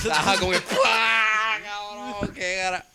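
high shrieking laugh through words; laughing hard. Real, not acted. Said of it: "yo me estoy riendo".

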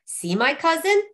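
high-pitched, pleased exclamation; exclaiming. A narrator's reading. Youth to his warmed dead cousin, exclaiming. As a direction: show it off with a lift in pitch. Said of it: "he exclaimed".

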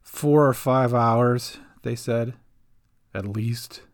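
slow, tired and resigned; weary sigh. Acted. Seasick Gran telling Cat how long, weary and slow. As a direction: let the words sag with weariness. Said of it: "Gran sighed".

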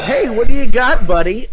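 high, loud mock host greeting a buddy; Keighley. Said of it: "Keighley, you know".

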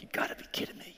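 whispered, exasperated aside; muttered disbelief. Retold or imitated. Pastor hiding from church members whispers his exasperation to himself. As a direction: breathe it out under your breath.

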